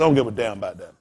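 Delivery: low, laughing dismissal; dad's low brush-off. Retold or imitated. Dad brushing off the neighbors, low laughing dismissal. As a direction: toss it off low, half laughing.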